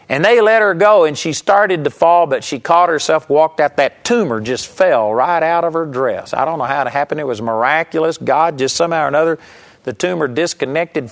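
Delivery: ordinary talk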